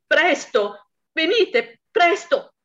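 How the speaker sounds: the wife screams down the phone to the police, frantic; frantic shout. Acted. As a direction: yell into the phone for help. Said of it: "gridò".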